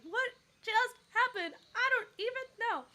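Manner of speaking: high, loud retold bewilderment; amazed disbelief. Singer retelling her reaction to the crowd's frenzy, amazed disbelief.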